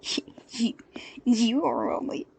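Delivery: breathy stammering thug; too stunned to finish; halting, incredulous snarl; sputtering brute. Acted.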